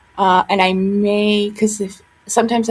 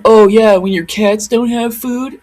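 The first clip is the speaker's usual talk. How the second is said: mocking sneer; 7 dB louder, slower than usual. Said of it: "the one guy's like".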